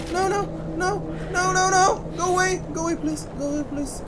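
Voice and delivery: high panicked pleading cries; scared horror game streamer. Real, not acted. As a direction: beg it to leave, terrified. Ghost chasing the player.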